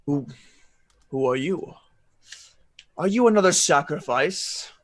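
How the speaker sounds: eerie voice from the dark; a hint of a ghostly voice; haunting voice. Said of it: "he hears an eerie voice".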